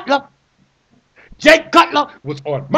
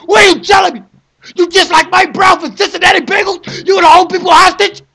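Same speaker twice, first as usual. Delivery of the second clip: high, loud, furious demand; outraged yell; angry shout; 5 semitones higher, 4 dB louder than usual. Real, not acted. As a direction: bellow it at the commissioner. Ranting, near crying.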